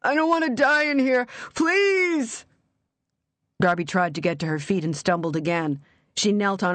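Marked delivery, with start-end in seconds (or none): 0.0-2.5 high, raw desperate begging; trapped man begging up the pipe; terrified plea. A narrator's reading. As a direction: beg for your life. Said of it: "raw, terrified voice".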